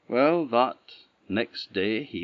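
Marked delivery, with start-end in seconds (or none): none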